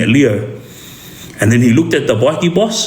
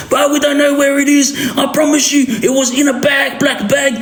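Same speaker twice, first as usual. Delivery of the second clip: high, frantic pleading with a shouted edge; panicked pleading; 13 semitones higher than usual. Retold or imitated. Tied-up uncle begging the bikie boss, frantic high pleading. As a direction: beg for your life in a high panicked voice. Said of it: "carrying on about".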